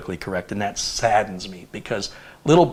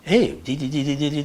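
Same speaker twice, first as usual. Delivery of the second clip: higher, taunting nonsense syllables; mock bullying; 4 semitones higher than usual. Somebody mimicking another to bully, mock taunting. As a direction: imitate someone mocking how another talks. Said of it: "a bullying situation where somebody's like".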